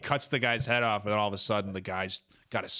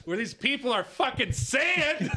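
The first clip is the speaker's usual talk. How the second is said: high-pitched incredulous exclamation; mock outrage. Comic playing up a misheard insult, mock outrage. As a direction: cry it out as if scandalised.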